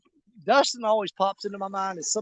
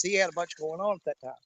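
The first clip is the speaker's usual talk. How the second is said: ordinary delivery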